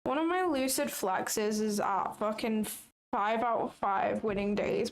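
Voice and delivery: usual speaking voice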